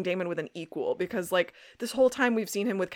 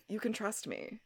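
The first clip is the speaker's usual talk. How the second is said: soft, much quieter sincere line; earnest reassurance; 10 dB quieter than usual. Retold or imitated. Host retelling Rose's moment with Damon, soft and earnest. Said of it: "very nice, earnest moment".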